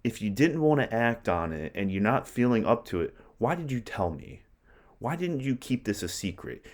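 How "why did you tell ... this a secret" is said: reproachful paraphrase. Retold or imitated. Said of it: "Lady Macbeth is saying here".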